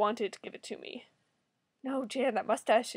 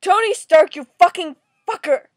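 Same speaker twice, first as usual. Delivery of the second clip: loud, high angry shout; outraged full-volume cursing; furious name-calling; 4 semitones higher, 12 dB louder than usual. Real, not acted. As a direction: yell the insult at the character. Cursing the comic character.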